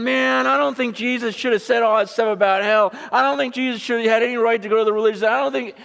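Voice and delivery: high, loud mock objection; hammed-up know-it-all protest; arrogant. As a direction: act out an arrogant complainer in a high voice. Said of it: "because we're so arrogant".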